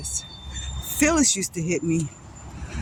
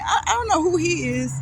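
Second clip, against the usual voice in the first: real tearful break, higher in pitch; real crying; 7 semitones higher than usual. Real, not acted. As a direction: voice cracking with real tears, pitched up. Teller breaks down over father.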